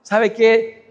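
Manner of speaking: normal talking voice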